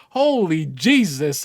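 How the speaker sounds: ordinary talk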